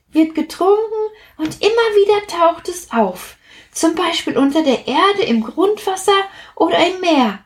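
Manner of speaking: high, bright little drop explaining; cheerful explaining drop. Acted. Platsch comforts Plitsch about what becomes of them, high and cheerful. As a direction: reassure your friend with bright certainty.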